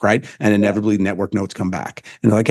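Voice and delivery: ordinary delivery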